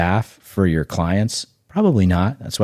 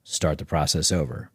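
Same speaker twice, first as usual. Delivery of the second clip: normal talking voice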